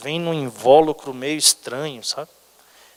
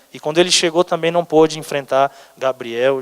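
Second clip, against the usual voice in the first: ordinary talk, nothing marked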